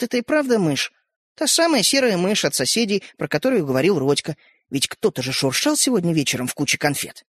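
usual speaking voice

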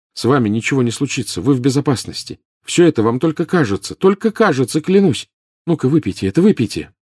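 high, pressing, pleading reassurance; anxious, insistent urging; urgent reassurance. A narrator's reading. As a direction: coax him urgently, pitch climbing. Harry urging drink on suffering professor.

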